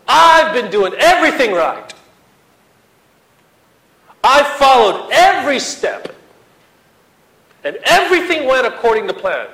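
loud, high, hammed-up protest to God; Elijah's complaint. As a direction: rant at God like the wronged prophet. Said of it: "That's what he's saying".

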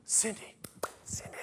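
soft whispered calling. Acted. Jesus whispering to college Cindy, hushed. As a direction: breathe her name gently.

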